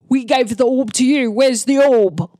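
angry orc priest demanding the orb. Acted. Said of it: "and he said".

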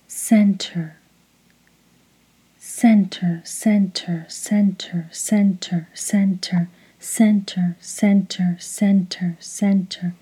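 soft repeated mantra, each word falling away; hushed, rhythmic one-word meditation cue. Shown as a demonstration. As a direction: lead a call to repeat, evenly timed. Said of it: "repeat each word after me, following the rhythm".

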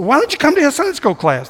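high-pitched imitated pushy coaxing; pushy invitation. Scottish church member inviting a newcomer to class, imitated pushiness. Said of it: "a pushy invitation".

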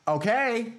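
loud, high, drawn-out okay; mom's second warning. Acted. Mom hearing another fight, slow loud high warning. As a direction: stretch it out as a warning. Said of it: "And you'd be like".

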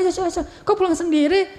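high-pitched retold chasing call; calling after someone. Husband catching up with Aisyah, calling out in concern. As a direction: call out to her as you catch up. Dikejar sama Rasulullah.

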